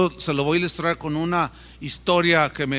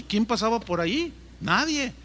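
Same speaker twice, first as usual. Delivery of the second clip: ordinary talk, nothing marked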